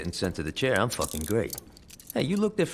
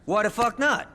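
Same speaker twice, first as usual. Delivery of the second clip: higher, louder indignant outburst; outraged yell; 14 semitones higher, 5 dB louder than usual. Acted. Defendant yelling back at the judge, outraged. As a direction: snap back at the judge in disbelief.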